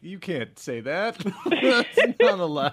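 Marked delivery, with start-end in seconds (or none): 1.2-2.7 high, loud, slow laughing protest; laughing mock outrage. Real, not acted. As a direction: laugh out a fake scolding.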